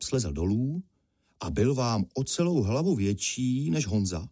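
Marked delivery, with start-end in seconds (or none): none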